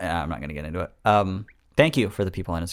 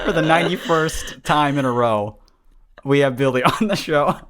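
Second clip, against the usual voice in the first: laughing announcement; 7 semitones higher, 6 dB louder than usual. Real, not acted.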